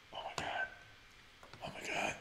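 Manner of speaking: hushed, breath-only whisper; awed whisper. Real, not acted. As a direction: whisper it in amazement. Awed by the game shop.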